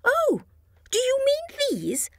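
put-on very high little girl voice; Chickpea voice. A narrator's reading. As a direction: squeak it high and innocent. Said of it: "asked Chickpea".

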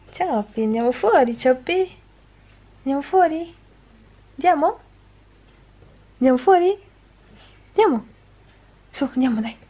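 high coaxing voice to a pet; talking to a dog. Real, not acted. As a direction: coax the dog to come out.

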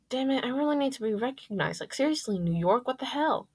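high-pitched, exasperated complaint; exasperated griping. Acted. Narrator's exasperated aside after a sigh.

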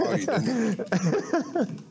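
laughing realization. Real, not acted. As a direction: let it melt into a laugh.

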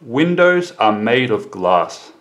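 slow model answer falling on GLASS; falling answer intonation. Shown as a demonstration. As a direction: bring the voice down onto GLASS. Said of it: "the intonation must fall".

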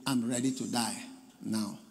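a lightly voiced low, soft croak of an old apostle; croaking dying Paul. Preacher voices the dying Paul, lightly croaking. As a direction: croak it out faintly, near death. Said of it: "With his croaking voice".

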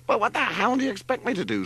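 fast, high-pitched angry snarl. A narrator's reading. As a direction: snarl back in a rush. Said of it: "snarled Larry".

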